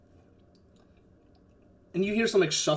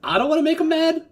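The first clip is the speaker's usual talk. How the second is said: high, loud, fast protest; man outside; 12 semitones higher, 8 dB louder, faster than usual. Acted. Man outside who fears making him mad, high hurried voice. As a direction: blurt it high and loud, in character. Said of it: "the man outside goes like".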